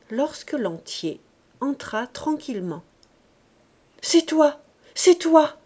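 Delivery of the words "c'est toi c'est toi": high, loud joyful cry. A narrator's reading. Wife crying out to her returning man, loud and high. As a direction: throw herself at him with a cry. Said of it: "cria-t-elle".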